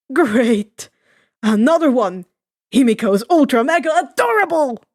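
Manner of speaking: ordinary delivery, nothing marked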